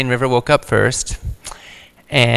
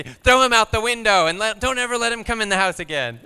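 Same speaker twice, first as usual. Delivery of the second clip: put-on higher-pitched kid voice demanding revenge; imitating angry child; 9 semitones higher than usual. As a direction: play the outraged little boy, pitched up. Quoting furious young son.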